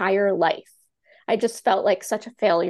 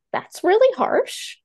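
stunned reply; 11 semitones higher, 3 dB louder, slower than usual. Retold or imitated. Reacting to harsh advice.

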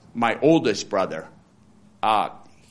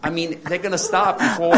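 ordinary delivery

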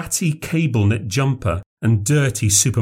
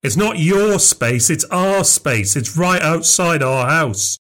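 higher and slow, defiant and offended; indignant claim; 6 semitones higher, slower than usual. A narrator's reading. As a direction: stand your ground, spelling it out.

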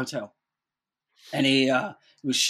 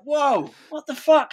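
high, slow shocked exclamation; mock startled outburst; 12 semitones higher, 4 dB louder, slower than usual. Whole plane reacting to the shark shout, mock startled outburst. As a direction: imitate a startled crowd.

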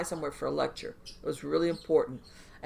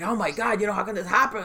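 mock upset; 3 semitones higher, 4 dB louder than usual. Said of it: "like, all upset".

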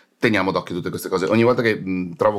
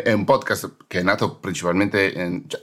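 ordinary talk, nothing marked

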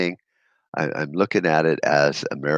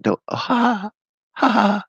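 mocking laugh. Mocking official laughs at him.

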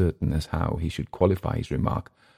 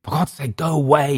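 raised voice, higher pitch; a shout to drive them off; 10 semitones higher than usual. A narrator's reading. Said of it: "he shouted".

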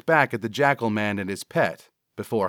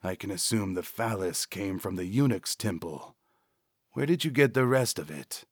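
soft throaty jackal, formal; suave jackal man; 6 dB quieter than usual. Acted. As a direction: question quietly as a courteous creature. Jackal character speaks.

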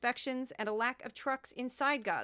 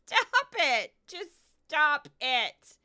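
exasperated; 4 semitones higher, 7 dB louder, much slower than usual. Retold or imitated. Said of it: "I was like".

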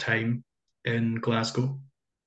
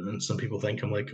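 ordinary delivery

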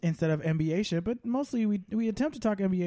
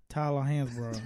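lower-pitched mock athlete voice; impersonating a player; 4 semitones lower than usual. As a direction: put on a low voice for a player. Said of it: "There, do an impression of".

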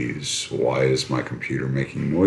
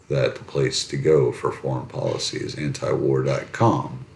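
ordinary delivery

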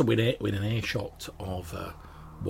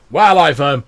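loud, raised dwarf voice; bellowing dwarf; 5 semitones higher, 12 dB louder than usual. Acted. Dwarf answering why he came north, bellowing. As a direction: explain it at booming volume. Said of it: "He's like, um".

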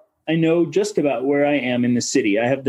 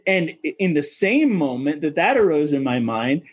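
normal talking voice

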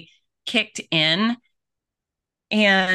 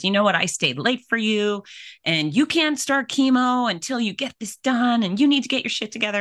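retold yell; 6 semitones higher than usual. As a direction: replay the tech scolding her with the pitch pushed up. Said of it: "she yells at me".

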